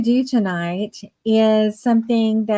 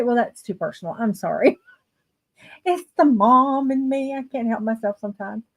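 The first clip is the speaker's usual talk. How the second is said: ordinary delivery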